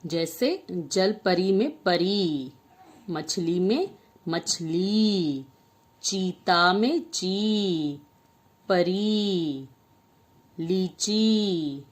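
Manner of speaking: put-on each word drawn out slowly for children; slow teaching read. Shown as a demonstration. Teacher slowly sounding out words for young pupils. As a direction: stretch every word so kids can follow. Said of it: "कुछ शब्द देखते हैं".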